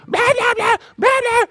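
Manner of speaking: squeaky high babbling, loud; mock kid noise. Son grumbling loudly in his room, squeaky babble. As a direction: babble like a kid making a racket.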